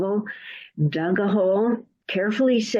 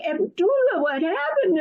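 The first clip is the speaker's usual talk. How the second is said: alarmed villagers; 11 semitones higher than usual. Acted.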